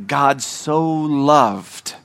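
"so loved" drawn out very slowly; stress on "so loved". Shown as a demonstration. Preacher quoting how the verse begins, "so loved" stretched emphatically.

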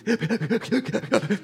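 high, rapid gasps for air; mock panicked hyperventilating; imitated gasping. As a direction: act out being unable to breathe. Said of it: "I couldn't breathe".

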